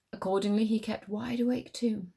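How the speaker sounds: ordinary delivery, nothing marked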